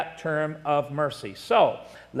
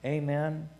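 soft, lowered, reverent preaching voice; hushed testimony; 5 semitones lower, 6 dB quieter than usual. Real, not acted. Preacher thanking God for his forgiveness, hushed and reverent. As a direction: drop low and quiet in gratitude.

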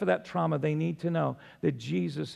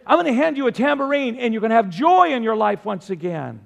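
high, loud, driving sermon delivery; full-force preaching; 6 semitones higher, 6 dB louder than usual. Real, not acted. Preacher telling how God comes in with joy, full-force preaching. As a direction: voice God's invitation with rising fervor.

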